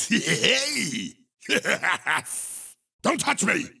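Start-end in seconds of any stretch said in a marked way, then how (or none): 1.5-2.2 brief, low laugh; gloating laugh. Acted. Game character's laugh.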